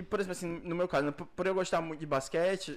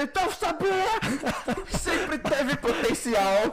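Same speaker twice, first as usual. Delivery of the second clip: raging gamer; 7 semitones higher, 4 dB louder, slower than usual. Pretend. Mock fan celebrating Cabo Verde.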